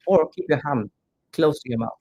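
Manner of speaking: normal talking voice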